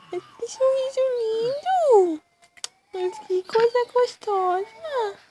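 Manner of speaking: high, squeaky cooing praise. Real, not acted. Mother cooing over her baby.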